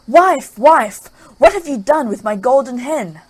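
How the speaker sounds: loud, high angry bellow; furious ogre shout. Acted. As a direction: roar for the wife in rage. Ogre shouting for his wife.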